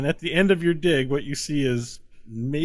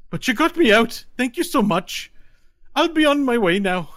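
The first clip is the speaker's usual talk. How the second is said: higher, louder Irish lilt; lightly Irish leprechaun; 11 semitones higher, 6 dB louder than usual. Acted. Leprechaun thanking his rescuer in a light Irish lilt. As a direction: thank him with a cheery brogue.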